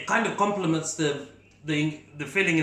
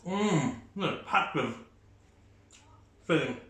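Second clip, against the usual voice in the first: talking through a mouthful; words round a bite of food; mouth full; 4 dB quieter than usual. Real, not acted. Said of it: "I can't even speak with my mouth full".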